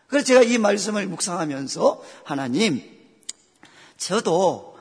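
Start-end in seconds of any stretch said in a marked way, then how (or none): none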